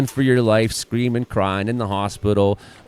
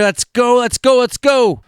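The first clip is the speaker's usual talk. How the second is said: excited urging; 12 semitones higher, 4 dB louder than usual. Real, not acted. Urging video to speed up.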